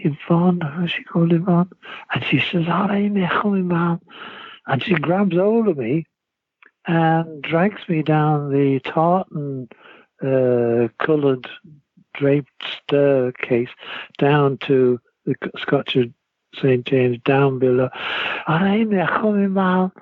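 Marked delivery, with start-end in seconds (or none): 2.7-4.0 a hint of a Scouse accent, pitched higher; lightly voiced Scouse girl; Scouse girl's greeting. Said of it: "she kept saying this statement". 18.5-19.9 put-on higher Scouse girl voice; higher-pitched Scouse accent; Scouse girl's greeting. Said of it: "she kept saying this statement".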